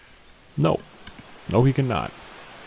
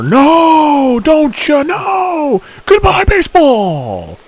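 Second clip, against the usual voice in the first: loud, much higher disbelieving shout; home run call; 11 semitones higher, 6 dB louder, much slower than usual. Real, not acted. Streamer stunned by another homer, loud excited shout. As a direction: yell it out in happy disbelief.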